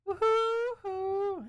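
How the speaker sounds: high, slow woo-hoo; drawn-out whoop. Real, not acted. Streamer whooping to the chat, long high hoot.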